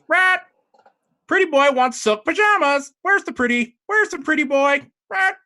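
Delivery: high, loud parrot squawk, far above her own voice; squawking baby phoenix. Acted. Baby phoenix squawks insults at the druid, high loud screech. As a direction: screech it like a rude little bird. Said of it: "and goes".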